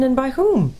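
high-pitched, urgent question; shocked demand. Acted. Caspian asking who betrayed him, shocked. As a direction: demand it in alarm.

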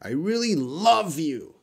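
"love" stretched and pitched high; stress on LOVE. Shown as a demonstration. Teacher showing how students emphasize a word, stress on "love". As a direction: make "love" the high point. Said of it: "They want to emphasize love".

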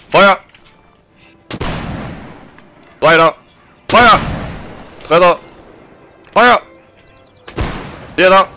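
barked drill commands. Acted. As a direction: call each man to fire. Calling each man to fire.

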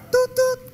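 high mouthed tut-tut beeps; imitating phone beep. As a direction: make a hold tone with your mouth.

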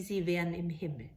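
plain reading voice

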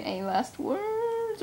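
high, slow, drawn-out mock threat; menacing villain. Joker gloating as he aims at Deadshot, high and slow.

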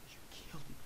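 soft whisper after dying; defeated whisper. Acted. Player killed by the rocket, whispered defeat. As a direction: breathe out the defeat.

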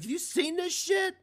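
high, loud outraged demand to the school board; indignant parent. Retold or imitated. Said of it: "and be like".

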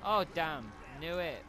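high, loud alarmed cry; startled shout. Real, not acted. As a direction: caught off guard, yelling. Enemy appears suddenly.

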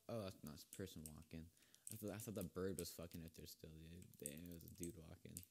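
ordinary talk

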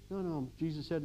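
ordinary talk

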